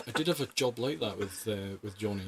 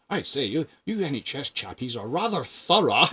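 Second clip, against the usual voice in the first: put-on posh old gentleman; 4 semitones higher, 4 dB louder than usual. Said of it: "very well-spoken, posh gentleman".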